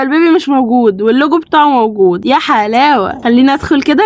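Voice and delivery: usual speaking voice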